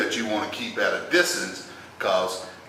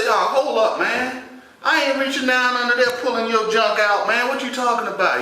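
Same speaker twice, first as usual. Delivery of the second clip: high-pitched, indignant mock protest; alarmed refusal; 13 semitones higher, 3 dB louder than usual. Nephew refusing to reach under his uncle, alarmed protest. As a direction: push back in a high, appalled voice.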